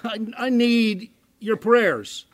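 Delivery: slow, hesitant plea on the phone; a caller's slow, halting request. Retold or imitated. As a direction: ask timidly for prayer. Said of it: "call you on the phone and say".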